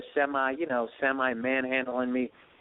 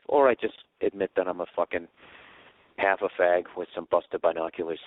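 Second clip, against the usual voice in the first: normal talking voice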